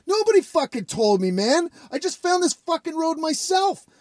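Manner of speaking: high-pitched defensive reply; acted indignant protest. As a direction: plead innocence in a mock-exasperated voice. Retold protest to accuser.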